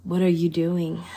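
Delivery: ordinary delivery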